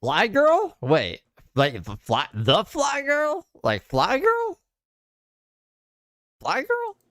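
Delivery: high, drawn-out disbelieving squeal; incredulous. Real, not acted. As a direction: stretch the name out in disbelief, very high. Incredulous repeats of caller name.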